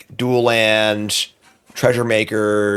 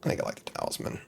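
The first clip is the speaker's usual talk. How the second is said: whispered musing; 15 dB quieter, much faster than usual. Real, not acted. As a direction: whisper it while deciding. Muttering to self.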